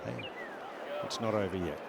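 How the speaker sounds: normal talking voice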